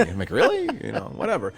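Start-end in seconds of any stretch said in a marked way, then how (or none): 0.3-0.6 high-pitched disbelieving quote; mock astonishment, pitch shooting up; incredulous. Teller quoting his own reaction, incredulous.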